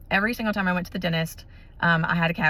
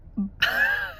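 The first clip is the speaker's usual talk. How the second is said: a very high, cracking laugh that turns into a cry; real tearful laugh breaking up; choked sob-laugh. Real, not acted. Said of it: "This is miraculous".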